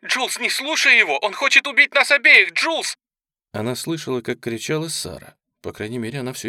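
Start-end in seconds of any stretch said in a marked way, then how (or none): 0.0-3.0 high, loud terrified screaming; desperate scream. Acted. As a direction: scream for help in panic. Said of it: "а затем крик".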